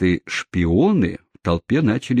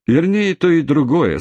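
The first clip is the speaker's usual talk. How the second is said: higher, louder sarcastic jab; dry retort; 9 semitones higher, 3 dB louder than usual. Acted. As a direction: throw it back with a sneer. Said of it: "сказал мистер Тампен".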